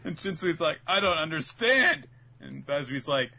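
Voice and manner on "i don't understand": high, loud confused cry; mock bafflement. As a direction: wail it in acted confusion. Voicing a baffled captain.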